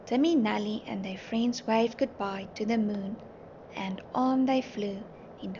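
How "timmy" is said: ordinary delivery, nothing marked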